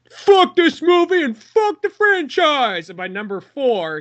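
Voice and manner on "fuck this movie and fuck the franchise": high, slow, hammed-up rant; exaggerated hater mimicry; mock outburst. Friend mocking co-host's old hatred loudly. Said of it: "and you were just like".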